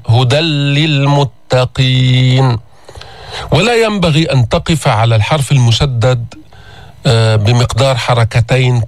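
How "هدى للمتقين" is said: هدى للمتقين with the nun fully merged into a doubled lam; full merge into lam. Shown as a demonstration. Teacher reciting هدى للمتقين to show the nun vanish into a doubled lam. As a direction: recite هدى للمتقين slowly, tanween swallowed into a stressed لل. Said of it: "تسمع لاما مشددة".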